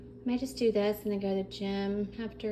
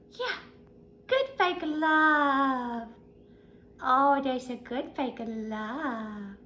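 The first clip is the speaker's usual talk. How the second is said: slow, higher cooing to the dog; pet talk; 3 semitones higher, much slower than usual. Real, not acted. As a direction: praise the dog in a sing-song coo.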